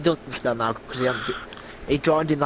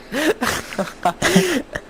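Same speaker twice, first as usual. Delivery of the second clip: high-pitched burst of laughter; real laugh; 3 dB louder than usual. Real, not acted. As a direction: burst out in a high laugh at your own story. Laughing at own posh boys story.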